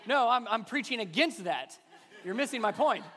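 laughing through the words; laughing as he defends himself; laughing protest. Real, not acted. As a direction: chuckle while insisting. Laughing with audience.

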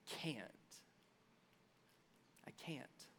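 very soft, barely voiced admission; defeated whisper. Preacher voicing a believer giving up before God, defeated whisper. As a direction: let the words die away to a whisper.

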